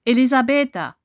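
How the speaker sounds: loud, high call of her name; calling energetically. Acted. Massimo calls the dead woman's name energetically at the table. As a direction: call her as if from the next room. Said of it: "Er rief ihren Namen energisch".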